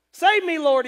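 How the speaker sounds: high, loud cry for rescue; shouted plea. Acted. Sinking Peter begs the Lord for help, shouted plea. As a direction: shout it like you're going under. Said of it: "he shouted".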